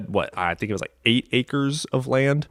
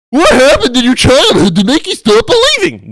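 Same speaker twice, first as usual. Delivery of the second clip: much higher and louder put-on voice of a prying chatter; mocking questioner; 11 semitones higher, 8 dB louder than usual. Streamer mimicking chat asking about her childhood, mock dramatic. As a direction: ham up a nosy, dramatic asker.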